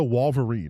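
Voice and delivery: "Wolverine" said WAHL-ver-een in mockery; mocking mispronunciation. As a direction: echo the odd WAHL in "Wolverine". Mocks the clip's odd pronunciation.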